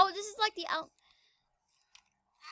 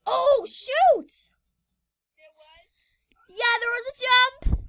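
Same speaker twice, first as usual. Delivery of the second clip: frustrated shout; 5 semitones higher, 7 dB louder, much slower than usual. Real, not acted. Missed a jump in game.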